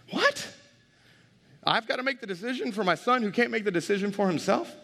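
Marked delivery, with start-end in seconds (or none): 0.1-0.5 sudden high shocked what; stunned what. Real, not acted. Father hearing the doctors' impossible choice, shocked. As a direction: blurt it out in disbelief.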